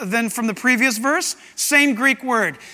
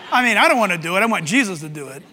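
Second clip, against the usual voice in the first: ordinary delivery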